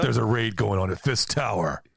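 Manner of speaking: radio voice. Pretend. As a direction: bark it like police radio chatter. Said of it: "I like that one better".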